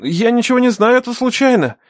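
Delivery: high-pitched panicked excuse; blurted denial. A narrator's reading. Young captive denies knowing anything, blurting in panic. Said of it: "выпалил он".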